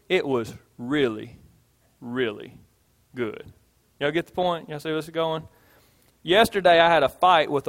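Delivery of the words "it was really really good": low, soft "really, really good"; hushed emphasis. Shown as a demonstration. Preacher demos emphasis with a meal, low and soft. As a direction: lean in and hush on the repeat. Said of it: "You wanna know how good it was?".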